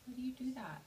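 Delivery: soft unvoiced whisper. Real, not acted. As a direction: breathe the question gently to the bird. Soothing the upset bird.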